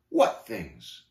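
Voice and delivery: low demand, falling hard; bristling snarl. Acted. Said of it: "snarled talkative, bristling like a hedgehog".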